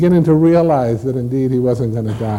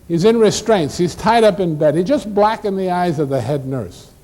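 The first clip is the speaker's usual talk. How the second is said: brisk, higher-voiced nurse with a tart edge; smart aleck nurse; 7 semitones higher than usual. Nurse offers a violent patient, brisk and tart. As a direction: rattle it off like a fed-up ward nurse. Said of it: "the smart aleck nurse said".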